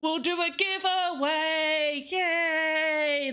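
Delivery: slow, drawn-out mock excitement; a tired streamer forcing a bit of cheer; half-hearted hype. Streamer to chat, announcing the giveaway with weak hype. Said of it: "That's about as much hype as I can get".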